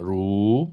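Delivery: RU dips low and swings up; long dipping RU; third-tone demo. Shown as a demonstration. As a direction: swing RU slowly through the third tone. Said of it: "nada tiga ru".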